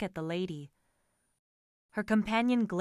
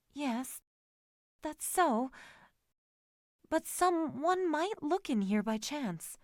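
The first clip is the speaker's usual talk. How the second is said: hesitate, pitched up, worried about being seen; nervous lady; 6 semitones higher than usual. A narrator's reading. Lady fearing they are noticed in the carriage, nervous.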